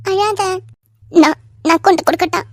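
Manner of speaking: loud, choked with tears; tearful. Acted. Mother handing the baby over, tearful. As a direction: speaks through crying.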